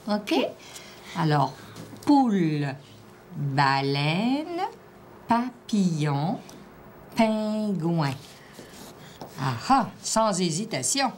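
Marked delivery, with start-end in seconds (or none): 2.1-8.1 slow, drawn-out single words; careful word dictation. Shown as a demonstration. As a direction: give each word room, stretching it out. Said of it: "Je te dis les mots".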